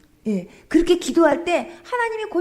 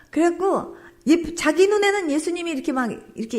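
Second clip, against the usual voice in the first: ordinary talk, nothing marked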